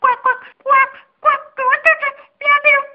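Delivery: very high, loud quacking, fast at the end; a duck quacking to be let out; duck's voice. Acted. Duck inside the dog quacking, high and fast. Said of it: "اردک هم گفت".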